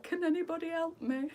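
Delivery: high mock pleading cry; desperate plea. Begging the warehouse staff for help, high mock plea. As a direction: wail it like you're drowning. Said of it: "yesterday I'm like".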